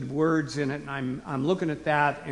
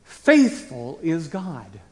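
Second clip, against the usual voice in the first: very slow, Faithful set first and weighted; stress on Faithful; 7 dB louder, much slower than usual. Shown as a demonstration. Preacher to congregation, slowly stressing Faithful. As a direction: let Faithful land slowly with force. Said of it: "emphatic position".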